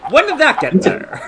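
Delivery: high pitched laughing question. Pretend. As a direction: squeak it through laughter. Character after walking into wall.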